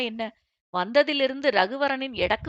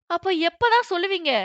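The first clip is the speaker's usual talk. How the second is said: higher, louder demand; exasperated demand; 6 semitones higher, 5 dB louder than usual. A narrator's reading. Employee demands an answer from the boss, exasperated. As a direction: push back with rising exasperation.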